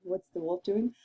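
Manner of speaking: ordinary talk, nothing marked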